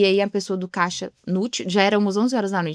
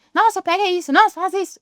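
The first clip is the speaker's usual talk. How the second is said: much higher, louder mock bossy shouting; imitated yelling; 10 semitones higher, 4 dB louder than usual. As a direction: imitate someone who barks orders at everyone. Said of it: "A pessoa fala gritando com as pessoas". (imitação de alguém gritando ordens)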